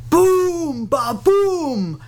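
crash it out like thunder; booming thunder. A narrator's reading. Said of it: "crashed the thunder".

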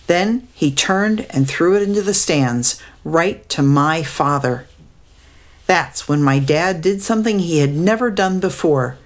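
plain reading voice

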